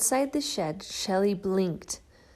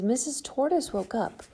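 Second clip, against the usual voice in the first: plain reading voice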